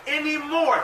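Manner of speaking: high, loud and slow, scripture preached; preaching. Acted. Preacher pleading the Lord's question in full voice.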